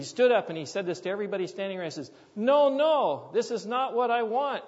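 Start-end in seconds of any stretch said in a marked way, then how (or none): 2.3-4.6 much higher, loud protest; protesting villager. Retold or imitated. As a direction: cry out in refusal. Villager rejects weak prayer.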